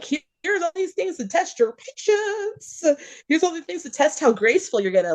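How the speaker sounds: the universe setting up tests for the praying person, teasing; voicing Source. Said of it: "Source is gonna be like".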